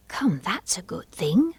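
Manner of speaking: whispered to herself. Acted. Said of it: "she said to herself".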